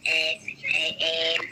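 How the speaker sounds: ordinary talk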